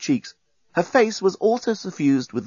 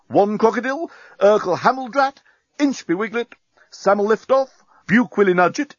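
brisk military roll call; rattling off names; 9 semitones higher, 4 dB louder than usual. Acted. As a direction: reel off names, higher. Captain lists corporals.

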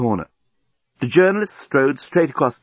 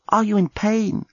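Lucy's voice; 10 semitones higher than usual. A narrator's reading. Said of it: "asked Lucy".

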